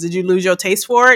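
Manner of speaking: ordinary talk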